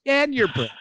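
high-pitched imitated retort; mock reply. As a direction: retell the crew's reply with the voice jumping up. Crew's retold reply, acted.

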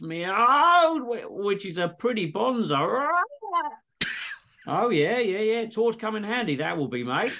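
high hammed-up yelps in Aussie; Aussie patient yelping while plunged; pained cries.

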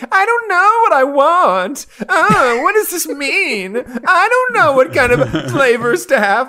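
very high, drawn-out squeal of a voice; weird kid voice. Retold or imitated. Uncle mimics his niece's silly ice cream talk, weird high voice. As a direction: put on an annoying, high, slow child's voice. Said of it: "talking in this weird voice".

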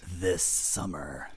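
low, slow trailer announcer; movie trailer voice. Retold or imitated. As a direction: drag it out like a trailer tagline. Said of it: "that movie announcer voice".

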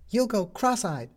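put-on high, puzzled young boy; boy asking, voiced high. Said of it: "said the boy".

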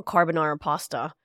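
normal talking voice

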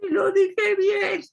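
put-on high, slow little-child plea; child's voice. A small child protesting to the parent, in a high slow plea. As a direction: whine it like a hurt toddler. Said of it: "te ve con esos ojitos de".

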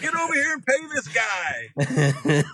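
high, drawn-out mock holler; calling someone over. Driver calling friend to pay attendant, mock holler. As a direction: summon a friend from across the lot.

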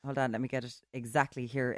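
ordinary delivery

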